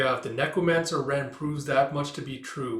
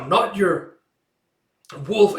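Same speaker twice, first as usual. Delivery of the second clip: loud, raised, forceful denial; defiant retort; 6 semitones higher, 9 dB louder, slower than usual. Acted. Micah answers the taunt in the nightmare, defiant. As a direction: throw the taunt back loudly.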